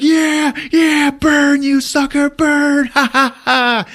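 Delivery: high shouting into a cackle; hammed-up crazed triumph; gloating mock yell. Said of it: "[name] is fucking laughing".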